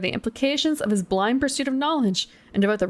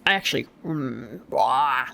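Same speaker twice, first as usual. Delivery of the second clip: gremlin voice; 8 semitones lower than usual. Shown as a demonstration. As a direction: lower gremlin croak. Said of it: "I can do gremlin voice".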